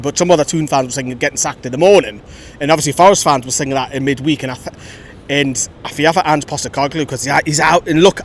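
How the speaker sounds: usual speaking voice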